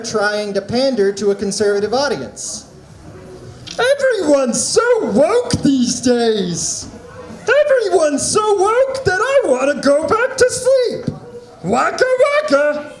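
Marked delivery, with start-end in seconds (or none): 3.8-12.9 Fozzie Bear impression. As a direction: play a hammy bear telling corny jokes, pitched up. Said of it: "my impression of Fozzie Bear".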